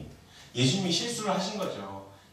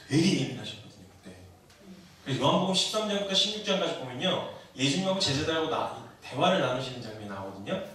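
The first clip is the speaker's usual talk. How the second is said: usual speaking voice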